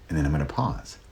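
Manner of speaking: ordinary talk, nothing marked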